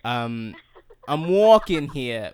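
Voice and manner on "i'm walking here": put-on drawn-out amateur New York accent, loud. Host attempting an accent on a friend's request, clumsy New York accent. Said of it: "asking me to do New York accent".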